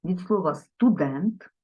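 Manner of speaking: normal talking voice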